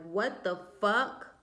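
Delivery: loud, drawn-out disbelief; shocked outburst. Retold or imitated. Teen hears her test result, loud slow shocked outburst.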